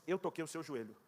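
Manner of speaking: God's voice answering the complaining player, low and solemn. Retold or imitated. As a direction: speak quietly from above, grave and calm. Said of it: "Aquela voz disse".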